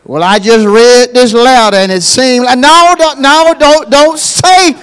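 loud, high, pleading preacher's cry; full-force preaching. Acted. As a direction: preach it hard, urging the church.

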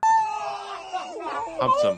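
high excited squeal; excited shriek. Real, not acted. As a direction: shriek it in delight. Reacting to dog video.

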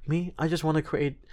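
usual speaking voice

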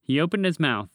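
plain reading voice

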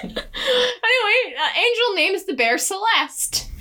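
high, drawn-out start through leftover giggles; still laughing. Real, not acted. Podcaster getting back to the recap after a laughing fit, laughing. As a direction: pick up the story while recovering from laughter.

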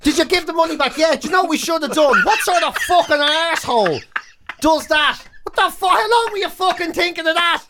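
usual speaking voice

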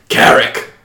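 rough half-orc call. Acted. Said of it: "half-orc turns to you, and he's like".

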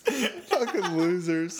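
slow, laughing jab; drawn out through laughter; laughing mock insult. Mocking the gondoliers.

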